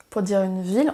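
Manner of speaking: ordinary talk, nothing marked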